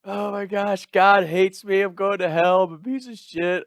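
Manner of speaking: high, loud, over-the-top wail; mock crying. Guy retelling his morning-after meltdown, mock crying. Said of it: "I'm crying like".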